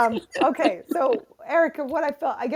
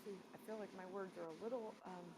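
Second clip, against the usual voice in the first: faint, distant audio; far from mic; 3 semitones lower, 25 dB quieter, faster than usual. Real, not acted. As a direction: voice dropping out far away. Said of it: "you just went really low".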